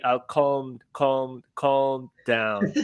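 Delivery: "calm" repeated carefully with the L sounded; practising the L. Shown as a demonstration. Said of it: "I will incorporate the L in there".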